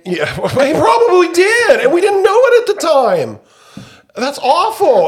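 a guilty laugh you can't hold in; high laughing. Real, not acted. Joking the deliveryman caught covid.

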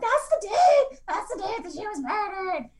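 high-pitched mock wail, loud at first; imitated ugly cry. Newcomer tells sponsor about the date, imitated high wailing cry. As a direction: re-enact wailing through tears, pitch shooting up. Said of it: "'Cause I did the ugly cry".

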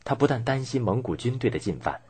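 ordinary delivery

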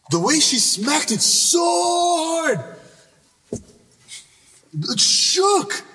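excited retelling. Retold or imitated. Car door slam retold.

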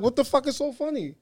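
acted-out incredulous demand; indignant disbelief. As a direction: retell a baffled, put-out reaction. Retelling his indignant reaction to laughter.

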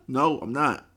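ordinary talk

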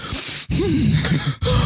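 heavy breathing. Shown as a demonstration. Showing how he breathed as a kid, heavy breathing. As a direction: demonstrate laboured breaths. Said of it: "breathe really heavily".

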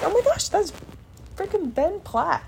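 high, hushed starstruck gasp. Retold or imitated. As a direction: gasp it out in disbelief. Recognizing a star.

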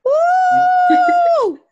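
high excited whoop; self-cheer shout. Real, not acted. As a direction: cheer for yourself loudly. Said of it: "I like how you self-cheer for yourself".

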